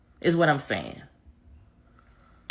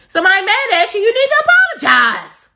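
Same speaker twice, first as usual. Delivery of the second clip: much higher, louder, insistent; rising shout; 11 semitones higher, 5 dB louder than usual. Real, not acted. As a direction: push it up into an exasperated yell.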